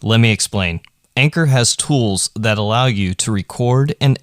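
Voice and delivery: light, even podcast ad read. Acted. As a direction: sell the app smoothly. A recorded podcast ad.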